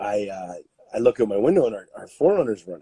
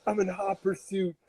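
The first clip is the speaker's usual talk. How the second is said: self quoted; 9 semitones higher than usual. Retold or imitated. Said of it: "I'm like".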